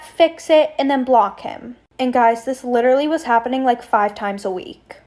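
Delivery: usual speaking voice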